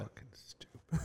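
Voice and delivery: whispered under the breath; whispered insult. Real, not acted. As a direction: hiss it so only the mic hears.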